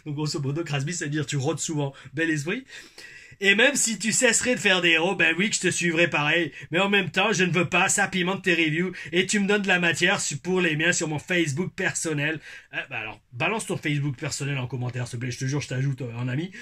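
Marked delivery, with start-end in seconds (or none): 3.4-12.4 put-on Québécois accent. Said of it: "mon accent québécois".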